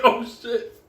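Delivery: high, loud mock panic; panicked realization. Friend sees the dog coming for him, acted panic. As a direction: cry out in acted alarm.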